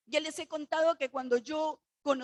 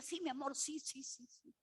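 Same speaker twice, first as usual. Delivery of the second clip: patronizing; 14 dB quieter than usual. Retold or imitated. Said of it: "se reía de mí, decía".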